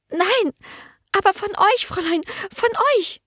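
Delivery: hohe, gehetzte Stimme einer verängstigten jungen Dienerin; drängend, voller Angst, hoch gesprochen; ängstliche Magd. A narrator's reading. Merle voiced high. (verängstigte junge Dienerin, drängend)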